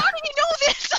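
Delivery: very high exclamation of disbelief; incredulous outburst. Retold or imitated. Host baffled at L always being ahead, incredulous. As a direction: push the pitch way up, baffled.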